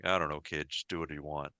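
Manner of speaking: low, soft, offhand brush-off; nonchalant Harrison Ford. Retold or imitated. Han Solo actor brushing off a puzzled co-star, nonchalant. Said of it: "nonchalant Harrison Ford way".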